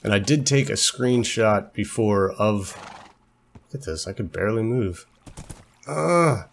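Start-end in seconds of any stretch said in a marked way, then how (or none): 5.9-6.5 high frustrated "ugh"; exasperated groan. Real, not acted. Rider stuck with a slow horse, exasperated groan. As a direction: let out a fed-up groan.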